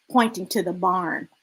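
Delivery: plain reading voice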